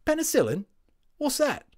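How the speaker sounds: put-on high, bright boy asking; young boy, puzzled. A narrator's reading. Boy voiced in story.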